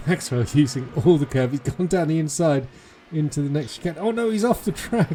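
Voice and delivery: excited motorsport commentator. Real, not acted. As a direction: call the move, building to a cry. Live call of overtake and spin.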